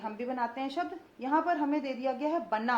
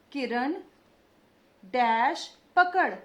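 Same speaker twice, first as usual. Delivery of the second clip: slow, louder, every syllable clear; slow dictation; 6 dB louder, slower than usual. Shown as a demonstration. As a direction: dictate it slowly to the class. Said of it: "देखते जाइए मैं कैसे उच्चारण कर रही हूँ".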